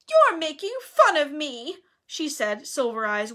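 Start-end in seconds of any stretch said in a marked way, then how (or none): 0.1-1.8 high, loud, whiny accusation; hurt ghost girl protesting; wounded Myrtle. A narrator's reading. Myrtle suspecting Hermione of mockery, wounded and whiny. Myrtle suspicious, near tears.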